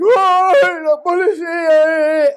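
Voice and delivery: high, drawn-out mock wail, very loud; mock outcry. Mimicking outraged people reacting to the police video, mock outcry. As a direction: cry it out loud and long, hammed-up.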